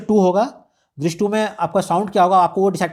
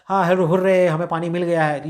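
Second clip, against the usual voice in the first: usual speaking voice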